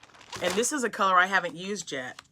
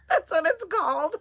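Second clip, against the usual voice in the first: laughs the words out, much higher and louder; laughing; 14 semitones higher, 10 dB louder than usual. Real, not acted. Reading a funny yarn name, breaking into high laughter. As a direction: giggle through the name.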